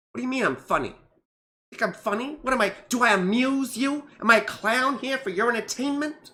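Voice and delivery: rising, mock-offended tough guy; Joe Pesci Goodfellas rant. Quoting Joe Pesci's Goodfellas funny-guy rant, mock offended. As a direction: build from puzzled to menacing. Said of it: "He's like".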